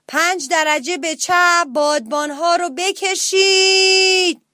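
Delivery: high, loud, very slow long-held call; captain's shouted order. Acted. Parrot captain to the sailors, calling a drawn-out order. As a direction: stretch out the command across the deck.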